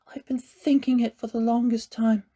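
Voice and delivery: whispered, struggling confession; slow and pained. A narrator's reading. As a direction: force the words out, hurting. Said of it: "Connor said slowly, painfully".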